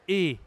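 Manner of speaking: E as a closed é, lips spread; crisp closed é on E; closed French é. Shown as a demonstration. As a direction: say E as a tight "ay" without the glide. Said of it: "la voix est un peu plus aiguë".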